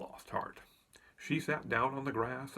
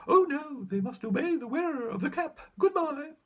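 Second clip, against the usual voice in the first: squeaky mouse queen; 6 dB louder than usual. A narrator's reading. Mouse queen reassures, leaves.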